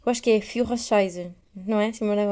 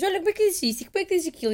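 lightly raised voice asking how to say things; higher voice, playful quoting of the Spanish flatmate; voicing housemate; 9 semitones higher, much faster than usual. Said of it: "E às vezes dizemos".

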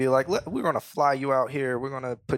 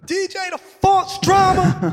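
[name] impression; 8 dB louder, slower than usual. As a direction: hype DJ shout, high and drawn out. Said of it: "He really talks like that".

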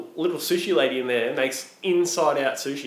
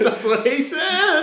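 high, loud, drawn out through laughter; gleeful punchline, cracking up; laughing innuendo; 6 dB louder, slower than usual. Real, not acted. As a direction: squeal the joke while laughing.

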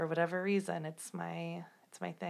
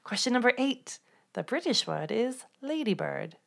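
put-on British accent; 5 semitones higher than usual. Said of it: "Did you like my accent?".

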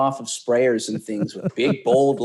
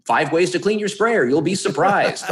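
hyped clickbait headline voice, higher and laughing; clickbait video narrator; 7 semitones higher, 4 dB louder than usual. Pretend. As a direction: sell it like a cheesy headline, grinning. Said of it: "some kind of clickbait".

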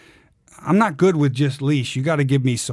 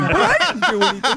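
mock innocent protest; 12 semitones higher than usual. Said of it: "what did I do".

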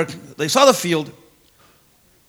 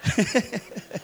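laughing at own joke; 7 dB quieter than usual. Real, not acted.

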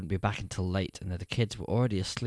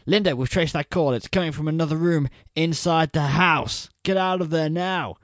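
higher, louder, pressing warning; urgent; 7 semitones higher, 6 dB louder than usual. Acted. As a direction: push hard to get her out of the house. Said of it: "His voice is urgent".

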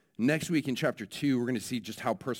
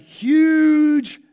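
higher and louder, stretched out in shock; shocked exclamation; 13 semitones higher, 12 dB louder than usual. Retold or imitated. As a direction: stress the word huge as if still horrified. Describing the child's broken arm.

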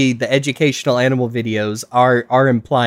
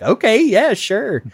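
sarcastic okay sure; 9 semitones higher, slower than usual. Retold or imitated. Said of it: "which I'm like".

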